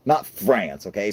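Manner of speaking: ordinary delivery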